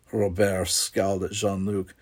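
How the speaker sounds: plain reading voice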